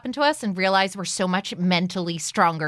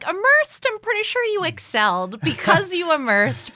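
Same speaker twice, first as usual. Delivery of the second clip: teasing disbelief; 10 semitones higher than usual. Retold or imitated. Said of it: "I was like".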